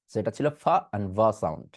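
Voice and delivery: normal talking voice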